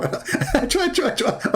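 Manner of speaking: high silly sneezes breaking into laughter; squeaky mock achoo while laughing; laughing fake sneezes. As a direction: giggle through the pretend sneezes.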